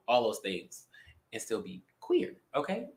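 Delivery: ordinary delivery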